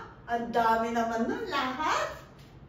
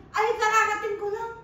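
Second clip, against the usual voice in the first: boss's excuse; 8 semitones higher, 8 dB louder than usual. Retold or imitated. Boss making an excuse.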